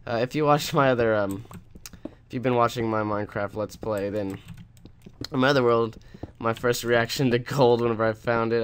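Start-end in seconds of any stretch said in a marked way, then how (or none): none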